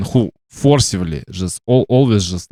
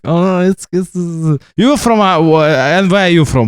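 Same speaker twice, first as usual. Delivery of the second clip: higher, flustered, stumbling question; mock confused asker; 7 semitones higher, 3 dB louder than usual. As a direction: act out a bewildered stranger fumbling for words.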